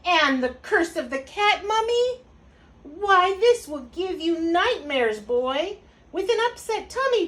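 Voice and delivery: high, loud, dramatic mother's warning; alarmed mama. A narrator's reading. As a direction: scold with mounting storybook alarm. Mama warns kitten about scary magazine.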